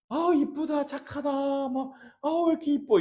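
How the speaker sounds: high-pitched cooing praise; gushing compliment. Acted. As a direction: coo over someone you adore, acted. Models kind compliments to church members.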